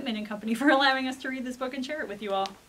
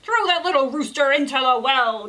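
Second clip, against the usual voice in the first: loud angry royal command; king orders the rooster thrown in the well, angry; 8 dB louder than usual. Acted. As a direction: order it in anger. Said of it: "The king was mad".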